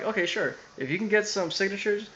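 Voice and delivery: high-pitched, put-on obliging voice; feigned agreeableness. Teacher humouring student's petition, mock agreeable. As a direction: imitate a teacher agreeing insincerely, pitched well up.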